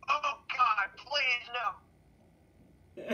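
panicked high quote; quoting a desperate office boss; Michael. Retold or imitated. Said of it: "with Michael saying".

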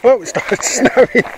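surprised laugh, slow and high; laughing surprise. Real, not acted. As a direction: laugh in disbelief at snow. Surprised by sudden snow.

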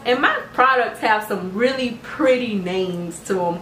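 ordinary talk, nothing marked

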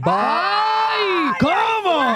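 a real gasp of shock, shouted high; shocked shriek. Real, not acted. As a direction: shriek in disbelief at the twist. Reacting to the twist.

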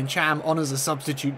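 ordinary talk, nothing marked

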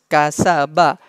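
Kasaba as one connected word; joined up. Shown as a demonstration. Teacher joining the syllables for students, joined up. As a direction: run ka, sa, ba together into Kasaba. Teacher spells out, then joins.

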